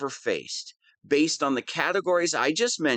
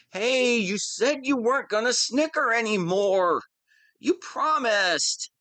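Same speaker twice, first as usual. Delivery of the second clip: whiny complaint; 7 semitones higher than usual. Acted. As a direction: sulk that she broke her promise. Second snicker interruption.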